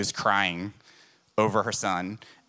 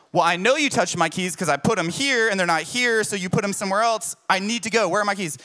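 higher, fast exasperated complaint; exasperated accusation; 8 semitones higher, 3 dB louder than usual. Retold or imitated. Husband late for work blames wife for moving his keys, rushed and higher. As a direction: accuse her, rushed and frustrated.

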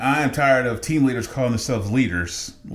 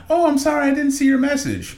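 much higher, sugary mock apology; mocking team lead. Team lead apologizing for the late reply, mock sweet high voice. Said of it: "And she was like".

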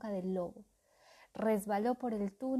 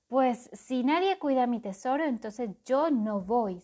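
digging in his heels; 3 semitones higher, 7 dB louder than usual. Acted. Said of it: "se plantó el cuervo".

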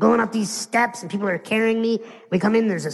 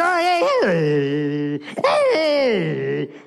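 jumpy mock greeting; much slower than usual. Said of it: "You don't hear him go".

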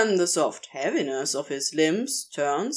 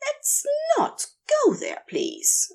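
chiding Aziraphale. A narrator's reading. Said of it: "Aziraphale chides him".

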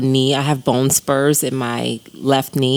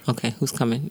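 hushed, much softer aside; quiet; 3 semitones lower, 8 dB quieter than usual. Retold or imitated. Mom voicing the baby quietly waiting for someone. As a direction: drop it to a quiet wondering. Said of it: "Like, quiet".

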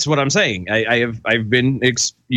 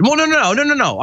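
excited protest; 12 semitones higher, faster than usual. Real, not acted. Protesting quickly.

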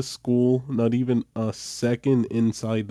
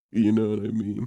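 usual speaking voice

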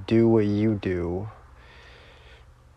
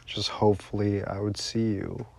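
ordinary delivery

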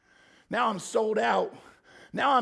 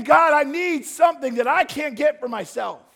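desperate shouted prayer; 7 semitones higher, 9 dB louder than usual. Acted. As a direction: pour out every need, begging. Begging prayer.

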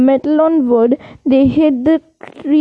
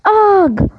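a sharp high pained cry; pained grunt; 6 semitones higher than usual. A narrator's reading. Ron hit by the attacking tree, pained cry. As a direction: yelp as the branch hits. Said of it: "said Ron".

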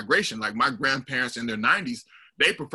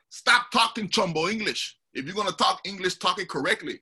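imitated scolding in a higher voice; mock telling-off, high and insistent; policing, scolding; 6 semitones higher than usual. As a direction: correct a cousin sternly, pitched up. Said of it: "they would police each other".

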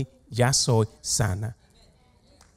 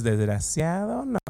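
laughing insult; 3 semitones higher, 5 dB quieter, slower than usual. Shown as a demonstration. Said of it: "así con esa cara, dile".

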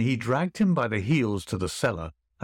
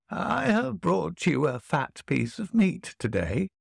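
put-on old woman voice; 7 semitones higher than usual. A narrator's reading.

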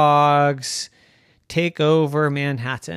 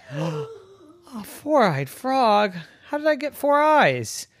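crying; 10 semitones higher than usual. Acted. Gilbert dismayed, cry heard.

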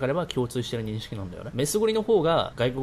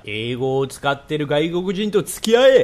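slow build to a loud shouted order; drawn-out, mock-dramatic shouted answer; emphatic command; 3 semitones higher, 8 dB louder, much slower than usual. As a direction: proclaim the advice, bursting louder on the last word. Blunt answer voiced as a command.

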